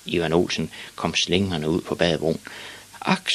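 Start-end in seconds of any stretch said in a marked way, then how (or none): 3.0-3.3 put-on small boy's resigned sigh; soft woeful "ak". A narrator's reading. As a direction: sigh it forlornly as a child. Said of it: "sukkede han".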